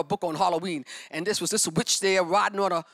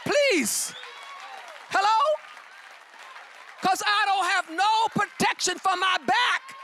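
preaching at full force; 10 semitones higher, slower than usual. Acted. Preacher calling out to the congregation, full force. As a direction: preach it out to the church.

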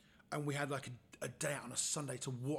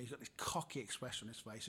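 normal talking voice

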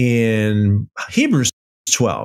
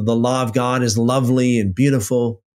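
normal talking voice

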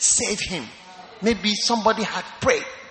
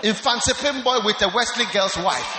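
preaching shout; 3 semitones higher than usual. Acted. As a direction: preach it big and teasing to the congregation. Preacher teasing at full force.

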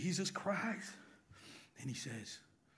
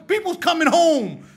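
much louder, higher, full-force; loud preaching; 9 semitones higher, 13 dB louder than usual. Real, not acted. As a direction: belt it out to the church.

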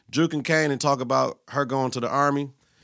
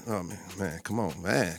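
much softer, low, trailing off; quiet; 9 semitones lower, 11 dB quieter than usual. Real, not acted. Vlogger stopping his rap, dropping soft and low. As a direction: let it fall away under the breath.

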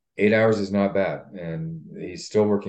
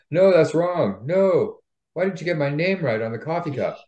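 demanding customer; 9 semitones higher than usual. Retold or imitated. Customers complaining at the counter.

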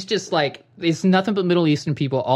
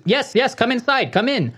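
high-pitched mock Indian-sounding accent; Ray; 8 semitones higher than usual. Ray on the phone insisting come in, imitated Indian-sounding accent. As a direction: keep urging them in, imitating an Indian accent. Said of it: "Why does he sound Indian?".

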